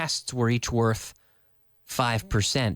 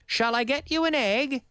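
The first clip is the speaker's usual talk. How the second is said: mock overly polite; 12 semitones higher than usual. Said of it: "when I'm talking to my brother".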